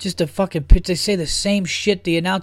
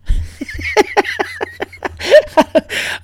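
high giggle. Real, not acted. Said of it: "I don't know why I've been doing that".

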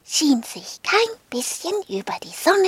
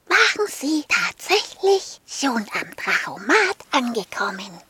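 plain reading voice

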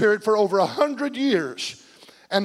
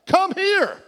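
yelled summons; 8 semitones higher than usual. Shown as a demonstration. Preacher showing what the word called means, a yell. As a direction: call someone over sharply. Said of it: "as to yell".

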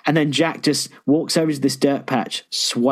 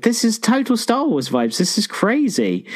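excited, higher-pitched gush; reliving the amazement of a first watch; amazed reaction; 7 semitones higher than usual. Retold or imitated. Host recalling a first viewing, amazed.

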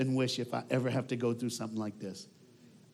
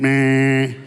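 air horn blast; 2 semitones lower, 11 dB louder than usual. Retold or imitated. Imitating Gary's air horn.

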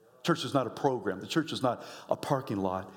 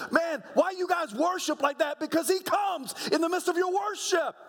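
full-force preaching. Real, not acted. Pastor preaching hard to his church, full force. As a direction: preach it to the rafters.

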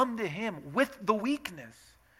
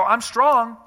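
mock proud claim; 4 semitones higher, 10 dB louder than usual.

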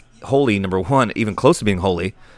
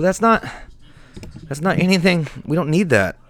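exasperated; 7 semitones higher than usual. Acted. Exasperated rejection.

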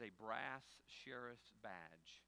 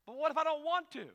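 whiny plea; 18 dB louder than usual. Retold or imitated. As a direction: beg like a kid. Pastor whining, retold.

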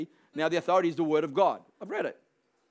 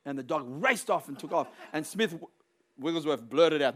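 ordinary delivery

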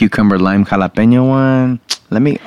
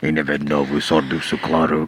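movie quote; 6 semitones lower, 7 dB quieter than usual. Retold or imitated. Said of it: "you're not quoting the movie".

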